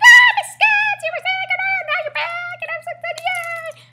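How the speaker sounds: very high squeal, loud burst at the start; excited students' welcome. Retold or imitated. Students greeting teacher back from sickness, mock excited squealing. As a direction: mimic gushing kids in a squeaky voice. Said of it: "everyone's like".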